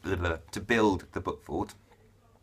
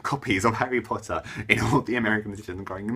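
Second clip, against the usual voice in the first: usual speaking voice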